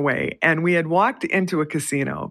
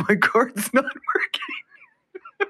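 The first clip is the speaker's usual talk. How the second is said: laughing through quote; much slower than usual. Retold or imitated. Friend confused, teller laughing.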